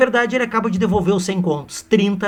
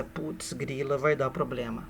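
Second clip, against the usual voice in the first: much softer muttered aside; under-breath worry; 3 semitones lower, 9 dB quieter, slower than usual. Retold or imitated. Seixas thinking to himself, softly worried. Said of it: "E ele pensa".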